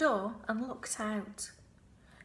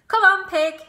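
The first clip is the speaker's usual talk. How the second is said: higher, loud call to the pig; 10 semitones higher, 14 dB louder than usual. Acted. Farmhand calling the pig to breakfast. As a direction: holler it across the yard. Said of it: "said Lurvy".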